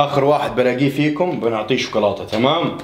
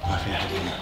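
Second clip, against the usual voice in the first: hushed, much softer; whispering while hiding; 5 semitones lower, 10 dB quieter than usual. Real, not acted. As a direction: whisper so they don't hear. Searching quietly for hiders.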